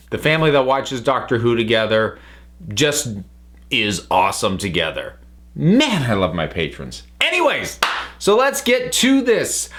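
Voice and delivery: usual speaking voice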